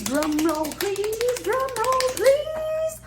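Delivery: building anticipation. Pretend. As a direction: build playful suspense toward a reveal. Building suspense before the letter reveal.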